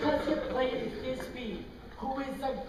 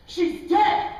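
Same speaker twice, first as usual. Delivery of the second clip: loud, lightly higher mock wail; hammed-up grief cry; 6 semitones higher, 11 dB louder than usual. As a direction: cry it out as in the play. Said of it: "I cry".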